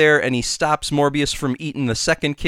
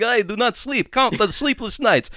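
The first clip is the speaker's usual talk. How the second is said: higher, counting like the vampire Count; 7 semitones higher, 3 dB louder than usual. Retold or imitated. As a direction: count the sleepless nights like the Count. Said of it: "a really good Count impression".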